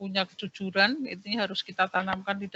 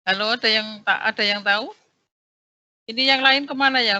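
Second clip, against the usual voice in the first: calling out; 2 semitones higher, 7 dB louder than usual. Real, not acted. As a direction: call out for an answer. Teacher calling unresponsive class online.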